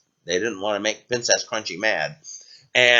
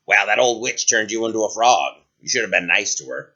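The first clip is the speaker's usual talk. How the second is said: low-pitched frog voice; deep creature gently rebuking; talking frog, matter-of-fact; 6 semitones lower than usual. Acted. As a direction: explain as a frog. Frog explains to princess.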